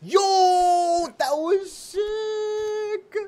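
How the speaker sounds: high, stretched-out excited yell; hyped shout. Real, not acted. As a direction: shout it out, thrilled. Reacting to a great jump.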